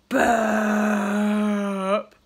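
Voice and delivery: loud, long belch; huge rumbling baby burp; giant burp. A narrator's reading. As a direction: burp so the house shakes. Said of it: "went the baby".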